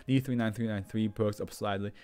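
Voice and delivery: usual speaking voice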